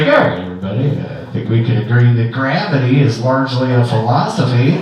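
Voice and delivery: low, laid-back loudspeaker voice; spacey pilot announcement. Retold or imitated. Pilot addressing passengers over the loudspeaker, low and mellow. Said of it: "pilot ... on the loudspeaker and was like".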